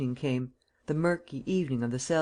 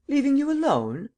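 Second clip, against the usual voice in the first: much higher and louder, caught off guard; startled protest; 11 semitones higher, 6 dB louder than usual. Acted. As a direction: blurt it out in alarm. Companion surprised at being sent away.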